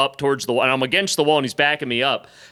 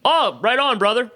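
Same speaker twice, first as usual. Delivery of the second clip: higher mock-hearty agreement; bluffed enthusiasm; 9 semitones higher than usual. Bluffing a frat code response to a drunk brother, over-hearty. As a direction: bluff along like one of the brothers.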